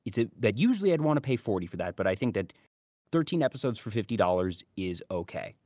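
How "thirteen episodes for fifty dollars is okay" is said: ordinary talk, nothing marked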